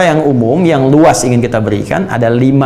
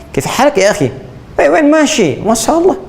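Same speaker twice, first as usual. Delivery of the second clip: playful mock Arabic accent, higher; 11 semitones higher than usual. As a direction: imitate an Indonesian speaking Arabic like a native.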